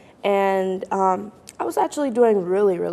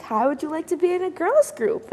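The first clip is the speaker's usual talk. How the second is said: a bright pitched-up imitation of mom; higher, mom's coaxing ask; 8 semitones higher than usual. Teen retelling mom's offer, higher coaxing voice. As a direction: repeat the offer high and coaxing. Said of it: "And she was like".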